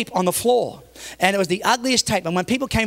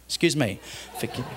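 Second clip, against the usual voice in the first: ordinary talk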